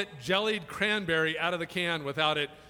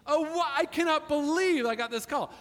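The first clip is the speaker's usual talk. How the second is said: officer's disbelief; 8 semitones higher than usual. Retold or imitated.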